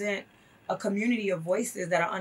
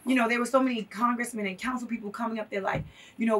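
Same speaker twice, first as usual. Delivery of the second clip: usual speaking voice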